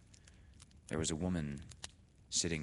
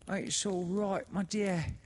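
put-on high, slow, louder comforting old woman; soothing sister; 5 dB louder than usual. A narrator's reading. The sister comforting the weeping widow, soothing. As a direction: soothe her, sly and sweet. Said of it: "said the other".

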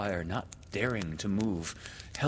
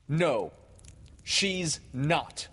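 stubborn denial; 6 semitones higher, much slower than usual. A narrator's reading. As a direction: deny it emphatically, word by word. Peter denies the child is hers.

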